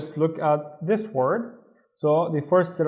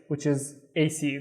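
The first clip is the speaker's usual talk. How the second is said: ordinary talk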